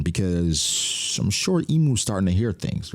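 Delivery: normal talking voice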